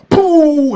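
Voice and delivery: high mouth-made explosion noise; imitated explosion. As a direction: make a bomb burst with your lips. Imitates a bomb going off.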